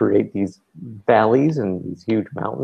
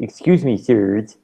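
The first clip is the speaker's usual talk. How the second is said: nerd voice; slower than usual. Pretend. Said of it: "I should have been doing it in the nerd voice".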